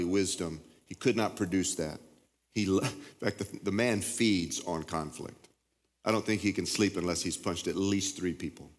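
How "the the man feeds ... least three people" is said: ordinary talk, nothing marked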